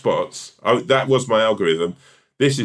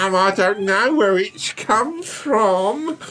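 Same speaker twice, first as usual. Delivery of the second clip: high, slow put-on voice; mocking impression; 9 semitones higher, much slower than usual. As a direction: mimic a coy innocent voice, slowly. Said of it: "It's my, my voice".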